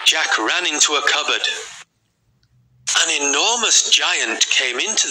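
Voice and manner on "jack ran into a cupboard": plain reading voice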